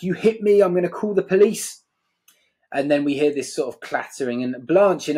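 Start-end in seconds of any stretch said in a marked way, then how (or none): 0.0-1.8 higher, raised voice, lightly shrieked; a hint of a shrieking wife; mock shriek. Neighbour wife shrieking back at husband, mild mock shriek. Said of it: "Eunice shrieks back".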